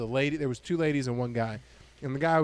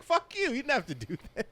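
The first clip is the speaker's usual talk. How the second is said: mock high retort; 10 semitones higher than usual. Retold retort to heckler.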